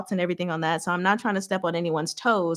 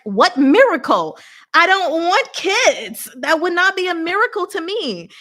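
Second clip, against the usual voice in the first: exasperated retort; 10 semitones higher, 5 dB louder than usual. Retold or imitated. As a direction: push back hard, spelling it out. Her retort to church people.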